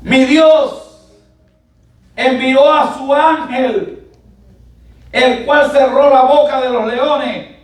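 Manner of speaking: high, loud, slow declamation; preacher voicing Daniel at full force; proclaimed testimony. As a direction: proclaim it phrase by phrase with long pauses. Proclaims Daniel's reply to the king.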